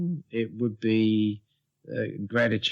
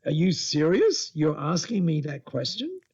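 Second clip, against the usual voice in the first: higher-pitched disbelieving question; mock incredulous; 6 semitones higher than usual. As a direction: question it as if you can't believe it. Acted incredulous reply in retold call.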